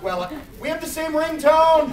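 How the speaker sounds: excited. Real, not acted. Shared ringtone discovered, laughing.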